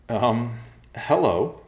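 slow, high, hesitant greeting; awkward hello, loud and drawn out; wide-eyed nervousness. A narrator's reading. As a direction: greet the bears very gingerly. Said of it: "still wide-eyed".

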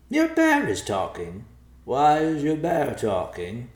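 a vulture who can't believe it; puzzled vulture. Acted. Narrator voices the vulture.

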